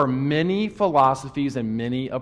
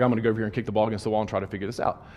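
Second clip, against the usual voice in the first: rushed inner thought; 3 semitones lower, much faster than usual. Retold or imitated. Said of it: "I was like".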